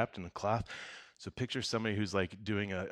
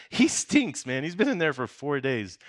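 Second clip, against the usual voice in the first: higher, louder mock complaint through laughter; mock disgusted sister; 5 semitones higher, 9 dB louder than usual. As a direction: play the fed-up sister, laughing. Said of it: "this is so funny".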